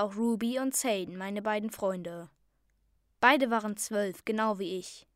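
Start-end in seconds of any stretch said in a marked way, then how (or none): none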